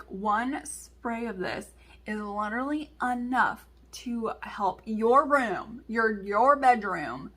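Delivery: slow, punched words, imitating mom; slow emphatic explaining. Mom explains room spray to daughter, slow and emphatic. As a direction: spell it out slowly, word by word.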